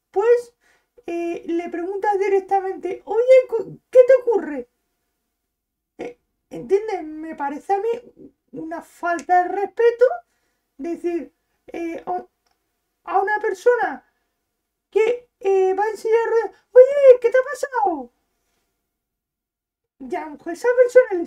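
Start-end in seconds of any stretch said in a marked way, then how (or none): 3.1-4.7 louder and higher, an abrupt nosy question; blunt nosy stranger. Retold or imitated. Mimics a blunt stranger asking. 16.7-18.1 louder and higher, an abrupt nosy question; blunt nosy stranger. Retold or imitated. Mimics a tactless question to a wheelchair user.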